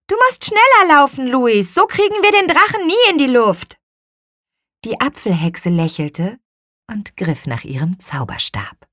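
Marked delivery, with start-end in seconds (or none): 0.1-3.8 loud, high urging shout; calling out. A narrator's reading. Girl urges Luis to run faster with the kite, high shout. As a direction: yell it high to get a runner moving. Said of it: "rief Lea".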